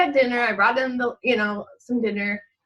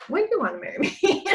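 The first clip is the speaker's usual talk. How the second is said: higher and fast, laughing through it; laughing question; 7 semitones higher, faster than usual. Retold or imitated. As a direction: tease him with a laugh, quickly. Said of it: "And I'm like".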